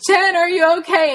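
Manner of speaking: high, loud yell. Retold or imitated. Dad coming through the brush, yelling to Jen. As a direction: call out at full voice. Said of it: "he's yelling".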